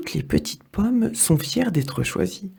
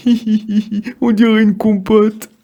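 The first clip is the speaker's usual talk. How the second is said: loud, high mocking giggle; can't stop laughing at her squashed friend; giggling; 6 semitones higher, 7 dB louder than usual. Trognon laughs at squashed Pépin, loud and high. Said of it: "ne peut pas s'empêcher de rire".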